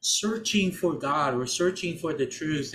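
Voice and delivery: ordinary talk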